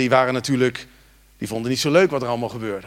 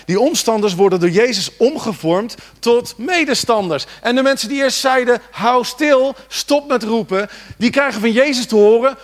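louder, higher, driving the point home; full-force preaching; 8 semitones higher, 7 dB louder than usual. Acted. Preacher urging the congregation to cry out, full force. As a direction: preach it out to the church.

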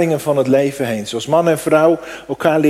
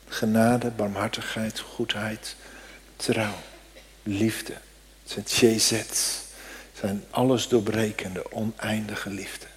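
hushed awe; 4 semitones lower, 9 dB quieter than usual. Real, not acted. Listing God's traits, hushed.

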